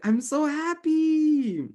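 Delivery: gushing forced cheer, very high and slow; overdone happy. Shown as a demonstration. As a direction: stretch out happy in a big sing-song. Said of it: "my intonation was still matching happiness".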